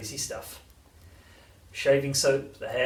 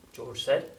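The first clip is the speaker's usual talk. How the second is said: ordinary delivery, nothing marked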